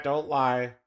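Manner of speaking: ordinary talk, nothing marked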